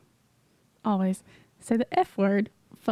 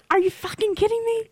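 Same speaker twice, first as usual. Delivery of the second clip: much higher mock road-rage yell; hammed-up Jersey driver outburst; a hint of an angry East Coaster in traffic; 11 semitones higher than usual. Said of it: "And then you're like".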